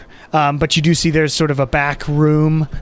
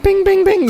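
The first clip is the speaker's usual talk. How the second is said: high bell ding; 3 dB louder than usual. Acted. GM voices the shop bell.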